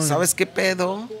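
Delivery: a drawn-out Mexican "¿Sabes qué pedo?" with the pitch up; slow Mexican slang drawl. Shown as a demonstration. Guest shows off his Mexican accent to the hosts, slow and high. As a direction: play the laid-back Mexican dude, slowly. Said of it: "sale como un poquito como así".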